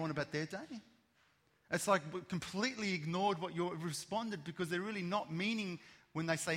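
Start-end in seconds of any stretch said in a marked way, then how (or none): none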